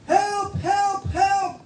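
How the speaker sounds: high-pitched acted calls for help; crying for help. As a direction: call out high like the trapped little boy, without extra volume. (kunwaring pasigaw na paghingi ng saklolo)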